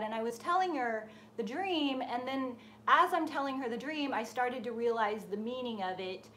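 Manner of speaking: ordinary talk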